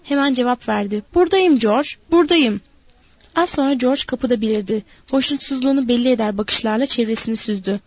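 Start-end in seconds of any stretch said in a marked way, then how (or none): 1.1-2.6 higher, louder answer; calling back. A narrator's reading. Lenny answers George from the room, calling back. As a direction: call back so he hears.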